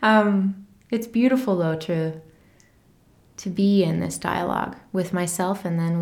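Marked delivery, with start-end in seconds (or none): none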